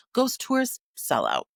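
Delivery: ordinary talk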